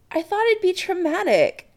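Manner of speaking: high plaintive protest. Acted. Adam to Chris, excusing his silence, plaintive. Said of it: "Adam says plaintively".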